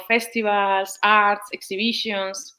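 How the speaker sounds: usual speaking voice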